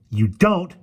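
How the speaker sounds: high, loud, angry contradiction; spat retort. A narrator's reading. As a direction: spit the words back at the witch. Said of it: "spat Ariel".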